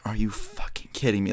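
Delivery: stunned, voiceless whisper; whispered disbelief. Retold or imitated. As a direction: whisper it under your breath in disbelief. Retold disbelief after being painted.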